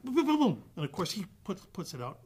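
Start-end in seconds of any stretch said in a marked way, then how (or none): 0.0-0.6 loud, high boom boom boom boom; mock gunshots. Guest acting out shooting the suspect, loud mock gunshots. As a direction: fire off the shots with your voice.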